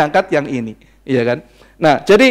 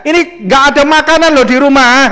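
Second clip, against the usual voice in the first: nagging wife; 8 semitones higher, 6 dB louder than usual. Retold or imitated. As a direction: nag like a fed-up wife. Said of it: "Pasti ngoceh kan?". (tiruan istri mengomel keras)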